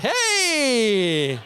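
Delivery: mock greeting shout. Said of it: "je fais".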